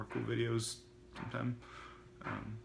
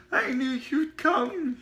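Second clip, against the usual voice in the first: put-on very high, loud and slow boyish cry; joyful cry; 9 dB louder, much slower than usual. Otto hugging the gas man, overjoyed. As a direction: wail it out with glee. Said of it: "he cried".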